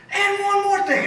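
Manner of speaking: high, loud yell bursting back into the room; furious comeback shout. Retold or imitated. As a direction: storm back in, still raging. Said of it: "He screams and cusses".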